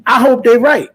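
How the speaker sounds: high, loud burst on I hope they right; excited hope. Real, not acted. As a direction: blurt it out like a fan who can't wait. Hoping for a big fight.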